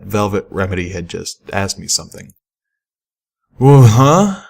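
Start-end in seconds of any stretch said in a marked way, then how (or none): 3.6-4.5 high, loud startled blurt. A narrator's reading. Caught daydreaming, Pip blurts out startled. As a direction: jolt out of a daydream.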